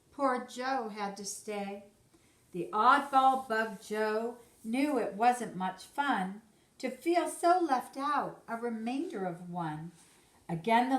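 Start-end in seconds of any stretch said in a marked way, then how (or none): none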